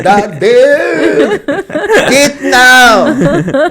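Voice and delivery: very loud, high, drawn-out holler; hammed-up yelling across the street; sing-song shout. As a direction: belt it out as a held call. Repeats the sung-out shouted answer.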